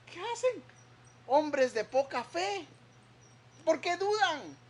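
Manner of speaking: high, reproachful questions; Jesus rebuking. Acted. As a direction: scold men of little faith. Jesus voiced rebuking disciples.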